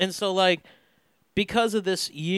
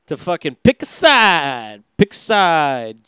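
louder, slow "pick a side"; emphatic; 7 dB louder, slower than usual. Real, not acted. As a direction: hammer "pick a side" slowly. Mocking election-year choosing.

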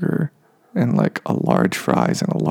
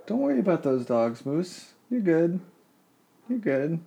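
cooing to the cat; 5 semitones higher, 6 dB quieter than usual. Real, not acted. Owner soothing cat Moose about dogs outside, soft high cooing. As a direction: coo gently to reassure a nervous cat.

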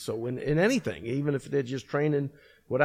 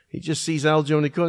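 ordinary talk

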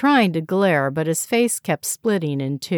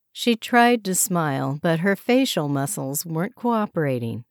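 usual speaking voice

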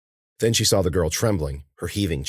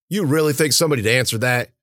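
raised, impatient retort; irritated snap; 5 semitones higher, 3 dB louder than usual. A narrator's reading. Warner mocking Mueller's call into darkness, snapping. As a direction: snap it at a foolish partner. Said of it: "Warner snapped".

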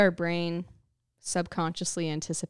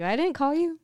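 high, loud imitation of Mom; mock indignant denial; 10 semitones higher, 8 dB louder than usual. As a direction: protest loudly in a pitched-up mom voice. Acts mom's denial.

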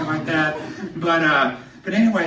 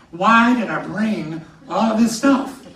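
exasperated groan; 5 semitones higher, 7 dB louder, slower than usual. Retold or imitated. Hauling bags up narrow stairs.

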